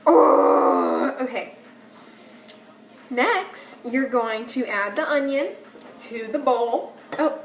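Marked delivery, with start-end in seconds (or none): 0.1-1.2 loud, voiceless groan near tears; big breathy, teary "oh"; loud tearful groan. Real, not acted. Overcome by onion tears.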